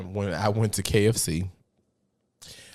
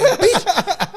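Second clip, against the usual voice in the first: much higher, louder mock Gwen; 9 dB louder than usual. Imitating Gwen yelling from the back, high. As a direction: squeal it like Gwen. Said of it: "She was in the back like".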